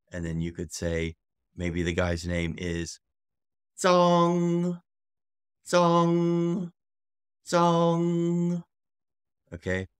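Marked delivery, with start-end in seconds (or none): 3.8-8.6 ZHONG held high and level; long, loud, steady high ZHONG; tone demonstration. Shown as a demonstration. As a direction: sustain ZHONG on one high pitch. Teacher demonstrates family name.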